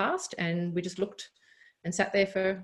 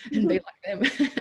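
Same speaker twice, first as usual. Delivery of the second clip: laughing; 7 semitones higher than usual. Real, not acted. Laughing at own modesty.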